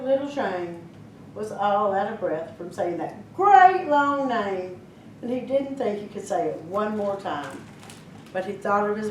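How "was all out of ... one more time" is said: usual speaking voice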